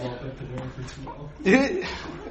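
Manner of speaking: soft, low chuckle; laugh. Real, not acted. Teacher tickled at finishing the whole book, low chuckle. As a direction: chuckle trailing on before speaking. Amazed at own productivity.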